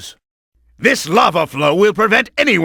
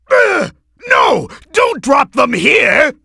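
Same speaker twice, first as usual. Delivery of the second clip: panicked shout; 6 semitones higher than usual. Acted. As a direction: cry out as your own bombs fall. Bombs falling on himself.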